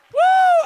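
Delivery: high, loud preacher's "woo"; exultant whoop. Real, not acted. Preacher at the peak of praise, high shouted whoop. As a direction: let out a piercing revival whoop.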